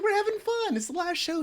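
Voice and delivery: high, laughing "we're having fun"; giddy excuse. Retold or imitated. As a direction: squeal it out like a cheeky teen caught. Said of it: "We're like".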